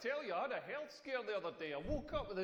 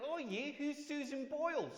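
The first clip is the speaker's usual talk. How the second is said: higher Australian flatmate voice; lightly voiced curious Aussie; Australian accent; 7 semitones higher than usual. Aussie flatmate asking who [name] is, higher Australian voice. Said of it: "He was like".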